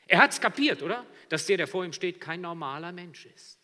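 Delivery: loud, high, soaring sermon voice; full-force preaching. Real, not acted. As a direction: build the scene with full preaching power. (predigen mit voller Kraft)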